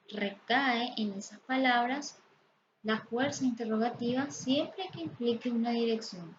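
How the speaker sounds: ordinary delivery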